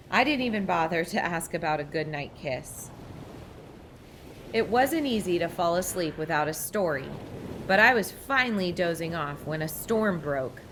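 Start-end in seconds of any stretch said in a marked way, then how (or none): none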